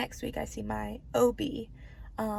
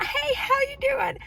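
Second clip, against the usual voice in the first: excited greeting; 7 dB louder than usual. Real, not acted. Greeting returning viewers, high far voice.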